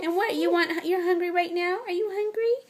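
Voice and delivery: lilting, high-pitched question to your infant. Real, not acted. Asking her baby if hungry.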